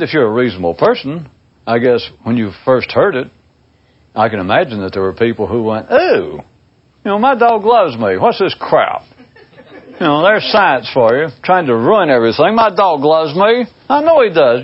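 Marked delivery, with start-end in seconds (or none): 5.9-14.6 higher-pitched mock protest; put-out, high-pitched objection; indignant dog lover. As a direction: play an offended dog owner refusing to believe it.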